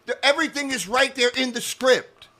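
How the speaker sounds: high-pitched, heated dismissal; exasperated rant. Real, not acted. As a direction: argue it down, pitched well above normal. Worked-up rant against script breakdown.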